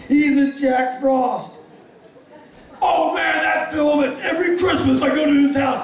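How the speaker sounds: high, loud gushing outburst; excited fan. Retold or imitated. Biker raving about a Christmas film, excited high voice.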